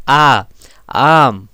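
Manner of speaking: आ and आम with the vowel held long; stressed, lengthened आ in आम; long vowel demo. Shown as a demonstration. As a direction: lean hard into a drawn-out आ, then आम. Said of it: "stress देना पड़ रहा है".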